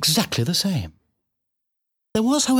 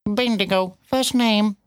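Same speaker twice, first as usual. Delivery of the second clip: high, drawn-out cat voice; bitten-off words; 8 semitones higher, slower than usual. A narrator's reading. Cat curtly questioning the piglet, words bitten off. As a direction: bite each word off and spit it out. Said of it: "seemed to bite off its words and spit".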